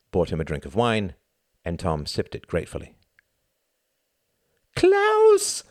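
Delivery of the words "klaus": very high, loud shrieked name. Acted. Greta spots Klaus in the crowd and shrieks. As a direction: shriek his name across the crowded room. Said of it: "shrieked a female voice".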